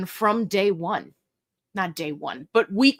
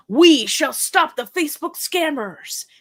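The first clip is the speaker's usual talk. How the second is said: grand, raised crusader voice; mock heroic declaration; 5 semitones higher, 4 dB louder than usual. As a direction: proclaim it like a superhero vowing justice. Grand declaration to partner Mary.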